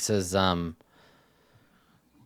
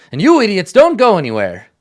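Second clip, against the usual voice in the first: louder, higher snap at the crew; scolding order; 6 semitones higher, 6 dB louder than usual. Acted. Captain ordering his crew to stay put, scolding. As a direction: turn and tell them off.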